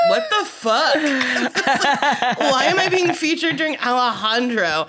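confused fan. Retold or imitated. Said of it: "was like".

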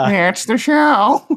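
high, slow goofy character voice; Michael J Frog voice. Pretend. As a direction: stretch it out in a silly cartoon voice. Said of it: "It's like a Peter Griffin".

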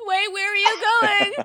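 high, loud frantic cry; panicked cry. Pretend. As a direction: shriek it in mock panic. Mock possessed screen plea.

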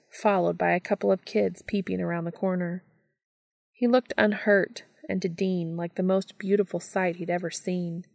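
usual speaking voice

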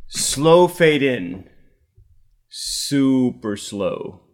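slow, deadpan stage-direction read; screenplay stage directions. Acted. Host reading the screenplay aloud, slow deadpan narration. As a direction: draw out each word like a film cue.

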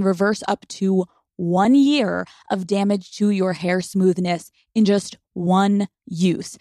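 loud, measured sponsor read. Acted. Host reads hair filler ad to listeners, polished ad read. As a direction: punch the product names, drawing them out.

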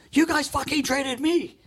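high-pitched imitation of an indignant protest; indignant retort. Traded player turns on a heckling fan, indignant retold retort.

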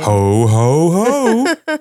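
booming Santa Claus ho ho ho; Santa laugh. Pretend. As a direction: play Santa, jolly and loud.

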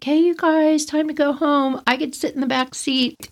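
put-on high-pitched, mild kid voice; lightly voiced child. Girl to friends after riding lesson, lightly voiced child.

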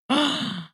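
a sudden high gasp of alarm; sharp gasp. Retold or imitated. Mother realising the nanny's phone rang in her backpack, sharp gasp. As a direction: gasp as it hits you that the phone is here.

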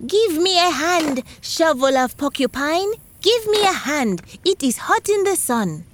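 high, called-out cry to the shovel; shouting. A narrator's reading.

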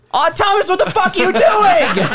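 high-pitched, hammed-up angry outburst; Austin yelling. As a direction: imitate an exasperated driver yelling. Acts out Austin yelling at him.

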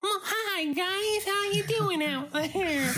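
put-on very high, slow baby voice; Baby Davey. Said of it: "please welcome Baby Davey".

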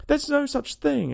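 high, slow, indignant objection; angry. A narrator's reading. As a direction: object crossly, stretching each word. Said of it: "very angrily".

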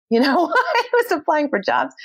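high laugh through the words. Real, not acted. Telling the interviewer about job hunting, a high laugh breaking through. Laughing at her own desperation.

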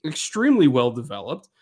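ordinary talk, nothing marked

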